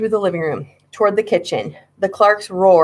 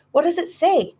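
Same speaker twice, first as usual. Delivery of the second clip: high impatient demand; 11 semitones higher than usual. A narrator's reading. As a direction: push him to read it out. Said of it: "I shouted impatiently".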